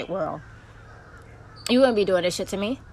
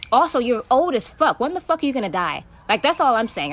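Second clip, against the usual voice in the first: angry outburst; 5 semitones higher, 7 dB louder than usual. Real, not acted. Ranting at her stalker.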